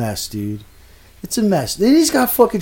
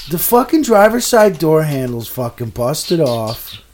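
ordinary talk, nothing marked

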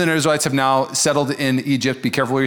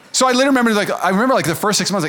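ordinary delivery

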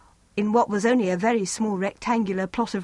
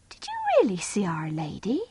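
whispered question; 3 dB quieter than usual. A narrator's reading. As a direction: whisper it, hushed and eager.

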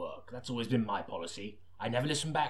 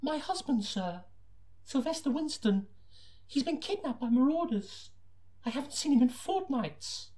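much higher falsetto lady voice; hammed-up high falsetto woman; young woman voice; 14 semitones higher than usual. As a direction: go way up into a breathy-free falsetto for the young wife. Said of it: "if you could just attempt the voice".

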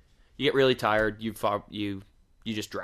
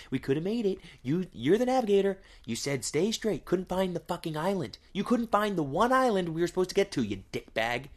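higher, nagging mock rant at the navigator; mock angry scolding; 6 semitones higher than usual. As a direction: chew out your partner like a fed-up pilot. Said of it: "He just got sick of Amelia Earhart".

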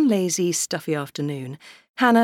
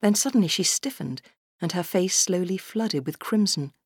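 plain reading voice

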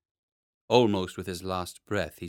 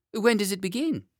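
put-on high, squirming child protest; a wriggling boy pushing the nurse away; whiny small boy; 10 semitones higher, 5 dB louder than usual.

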